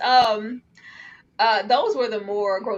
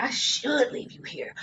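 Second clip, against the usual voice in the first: whisper; 4 semitones higher, 5 dB quieter than usual. Retold or imitated. Voicing the sister's thought.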